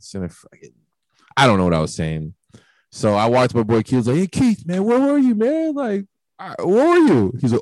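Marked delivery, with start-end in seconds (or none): 4.2-7.3 yelling for a friend, pitched way up; high drunken yell. Retold or imitated. Yelling at his friend Keith.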